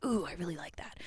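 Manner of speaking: soft, breathy whisper. Retold or imitated. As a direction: whisper it like a secret delight. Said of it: "I'm like".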